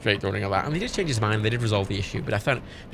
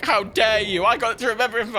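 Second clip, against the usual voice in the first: mock offended; 10 semitones higher, 4 dB louder than usual. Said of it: "he walked away angry".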